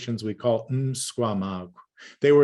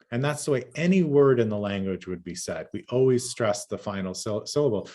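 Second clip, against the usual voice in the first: ordinary talk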